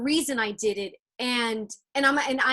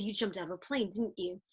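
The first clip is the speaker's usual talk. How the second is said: low, soft, knowing question; imitated dad; 5 semitones lower, 10 dB quieter than usual. Dad to skydiving daughter on phone, lightly imitated quiet certainty. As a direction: lightly voice a father who has guessed.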